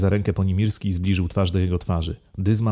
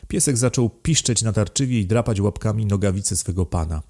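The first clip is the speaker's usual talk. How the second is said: ordinary delivery, nothing marked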